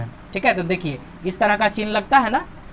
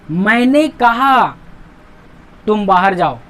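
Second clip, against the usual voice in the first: stretched "मैंने कहा", long break, "तुम बाहर जाओ"; slow lead-in, clear stop after कहा; pause after कहा; 3 dB louder, slower than usual. Shown as a demonstration. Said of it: "थोड़ा रुकावट आएगा इसमें".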